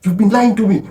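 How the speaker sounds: high, indignant mock outburst; acting out a wife losing her mind; outraged wife. Wife confronts her husband over lies, high outraged outburst. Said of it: "loses her mind".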